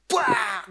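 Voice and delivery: loud imitated bang of a fist; shouted crash noise; mock smash sound. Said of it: "Itu rantang saya pukul".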